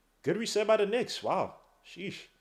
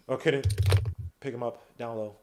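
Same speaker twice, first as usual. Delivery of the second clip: usual speaking voice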